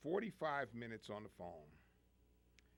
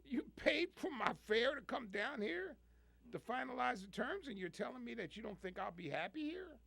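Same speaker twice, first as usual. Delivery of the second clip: retold disbelief, pitched much higher; mock outrage climbing high; incredulous protest; 11 semitones higher than usual. Caller retelling his protest to the boss, incredulous and high.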